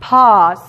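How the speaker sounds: one clean vowel in pause; correct pause. Shown as a demonstration. Teacher models pause for the class, careful demo. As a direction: pause with a plain open A and no U. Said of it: "No se escucha la U".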